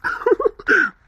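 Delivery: very high burst of laughter; husband laughing at his wife's playful threat; high amused laugh. Acted. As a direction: laugh off her mock threat.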